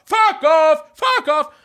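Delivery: high, loud repeated heckle; imitated friend's shouting. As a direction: yell it like a mate going wild at wrestling. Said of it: "han begynner å rope da".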